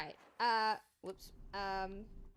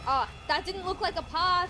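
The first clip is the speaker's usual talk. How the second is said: surprised; 8 semitones higher, 9 dB louder than usual. Real, not acted. Surprised mid chase.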